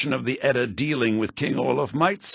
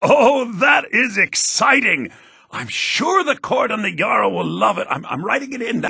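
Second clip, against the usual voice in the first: excited; 8 semitones higher, 5 dB louder than usual. Acted. Jarl excited by a tale.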